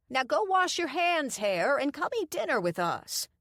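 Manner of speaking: wife calls her husband to wash up; bossy old lady. A narrator's reading. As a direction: order him like a doting wife. Doreen orders husband.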